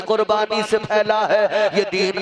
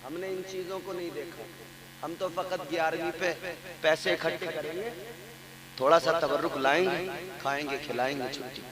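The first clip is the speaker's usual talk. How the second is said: mocking aside; 4 semitones lower, 12 dB quieter, faster than usual. Mocking lax worshippers' attitude.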